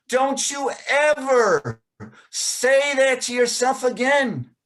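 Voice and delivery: put-on a firm mentor lifting a boy up, slowly; stern teacher. Teacher looking the boy in the eyes, stern and slow.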